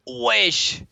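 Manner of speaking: screamed wesh, very high and loud; raging gamer. Real, not acted. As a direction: scream it in outrage. Screaming at enemy damage.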